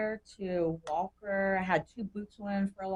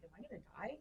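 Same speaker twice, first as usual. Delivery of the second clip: hushed retelling; 17 dB quieter, faster than usual. Retold or imitated. Retold hospital scene at night.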